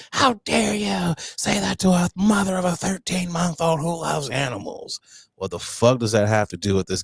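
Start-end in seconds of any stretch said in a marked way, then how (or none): 0.1-5.0 much higher mock indignant voice; mock outraged mother. Mocking the equestrian woman's outrage, high indignant parody. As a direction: shriek it like an offended mom. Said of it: "she got pissed and went ham".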